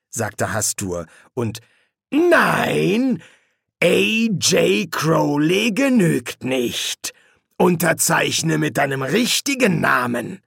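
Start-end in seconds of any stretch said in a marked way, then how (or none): 2.1-10.4 slow, high, forceful order; drawn-out, imperious correction; stern menacing demon. Acted. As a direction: lay down the law slowly. Hastur rejects the signature.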